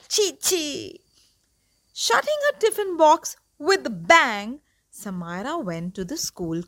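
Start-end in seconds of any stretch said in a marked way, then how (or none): 0.1-1.0 disgust. Acted. Child rejecting food in disgust.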